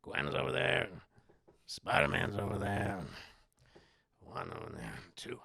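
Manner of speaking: very low, fading creaky voice; sinister muttering to himself, trailing away; scheming goblin. Acted. Goblin noticing Gwen, muttering to himself.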